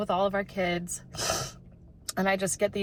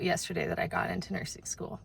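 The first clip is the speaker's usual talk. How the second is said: breathy whisper of overwhelmed joy; barely voiced, holding back tears; 9 dB quieter than usual. Real, not acted. Tearfully sharing her big news.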